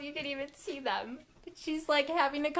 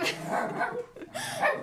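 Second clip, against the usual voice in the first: giddy high laugh; 13 semitones higher than usual. Real, not acted.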